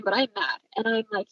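ordinary delivery

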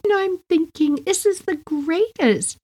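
put-on high, delighted little-girl voice. Four-year-old talks to her cat, high and delighted. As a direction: play yourself at four, thrilled with the game. Child self voiced in story.

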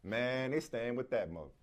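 low, soft, slow and offhand; casual inflection. Shown as a demonstration. Host demonstrating a casual, non-disrespectful way to say it. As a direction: toss it off casually, no hard edge. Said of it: "it's the inflection".

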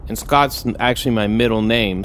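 normal talking voice

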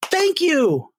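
high breathy thank you. Retold or imitated. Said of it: "he goes".

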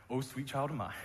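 soft, fond aside to the congregation; a gentle pitying sigh of a line; tender aside. Real, not acted. Pastor softly pitying the flock: tender hush. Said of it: "preacher's tender aside to congregation".

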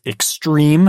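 "extreme" stretched out and hit hard; emphatic stress. Real, not acted. Doubting the trail's difficulty rating, emphatic stress on "extreme". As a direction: punch "extreme" slowly, making a show of it. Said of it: "say it with that emphasis".

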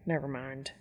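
quiet low mutter. Acted. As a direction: drop it under your breath.

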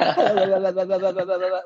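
high, open burst of laughter; real laugh. Real, not acted. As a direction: let a big giddy laugh out. Laughs at being told off.

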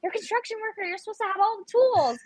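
high panicked complaint to dad; yelling at dad. Retold or imitated. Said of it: "Why are you yelling at me?".

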